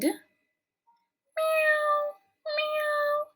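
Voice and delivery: high squeaky kitten meow; cat meows. A narrator's reading. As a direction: mew like a crowd of thirsty cats. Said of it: "cried the hundreds of cats".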